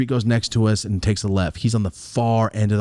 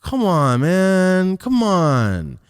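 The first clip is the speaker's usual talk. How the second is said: raised, louder complaint; drawn-out, higher-pitched "come on"; exasperated plea; 7 semitones higher, 4 dB louder, much slower than usual. Real, not acted. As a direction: plead loudly in exasperation. Exasperated reaction, outside the story.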